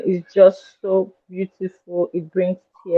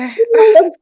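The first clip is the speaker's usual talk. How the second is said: high, loud laughing shriek; excited scream; 6 dB louder than usual. Real, not acted. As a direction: let out a startled, delighted shriek. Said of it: "Why did you scream?".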